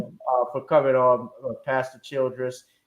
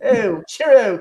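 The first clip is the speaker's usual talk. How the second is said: mock British; 9 semitones higher, 8 dB louder, slower than usual. Joking British farewell after accent.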